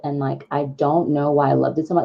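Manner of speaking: ordinary talk, nothing marked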